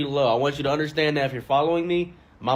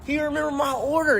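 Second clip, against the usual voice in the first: much higher, gushing amazement; touched delight; 12 semitones higher than usual. Retold or imitated. Comic gushing that the shopkeeper remembered his order. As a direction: gush like he remembered your birthday. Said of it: "And I was like".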